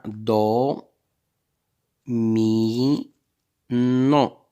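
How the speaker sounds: DO, MI, NON stretched long and loud with pauses; syllable by syllable. Shown as a demonstration. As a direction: draw out each syllable of "do, mi, non" separately. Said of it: "on va frapper les syllabes".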